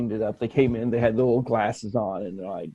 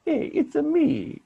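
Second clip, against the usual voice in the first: high-pitched cartoon Italian accent; mock Italian accent. Shown as a demonstration. Mocking his own failed Greek, comic Italian accent. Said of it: "I sound like Italian".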